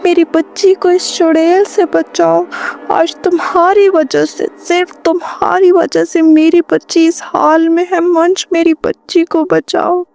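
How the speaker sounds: high, frantic begging; desperate plea. A narrator's reading. Mother begging husband to save their daughter, high and frantic. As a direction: plead for your child in panic.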